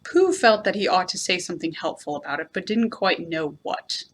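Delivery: plain reading voice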